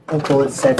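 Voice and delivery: ordinary talk, nothing marked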